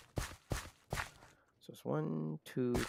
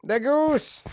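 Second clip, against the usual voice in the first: excited exclamation; 13 semitones higher, 4 dB louder than usual. Real, not acted. Sudden excited outburst at goose.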